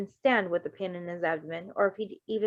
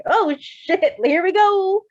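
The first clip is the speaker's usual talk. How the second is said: mock dread; 10 semitones higher, 8 dB louder, much slower than usual. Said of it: "I'm just gonna walk into that like".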